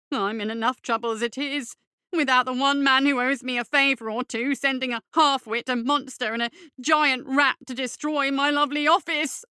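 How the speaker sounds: rising, tearful, self-pitying whine; weepy fop. Acted. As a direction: wail it toward tears. Tearful Derber complaint.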